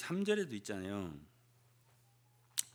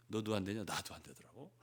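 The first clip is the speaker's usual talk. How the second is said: lower, softer resigned sigh; gloomy commiseration; 8 semitones lower, 7 dB quieter than usual. Acted. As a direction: sigh it in defeat to a friend. Gloomy reply between believers.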